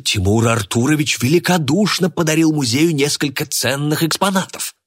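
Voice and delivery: high-pitched, flustered explanation; gabbling. A narrator's reading. Flustered organiser defends his guest, gabbling. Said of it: "затараторил Одинцев".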